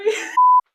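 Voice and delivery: sudden burst of laughter; high laugh. Real, not acted. Laughing at own story.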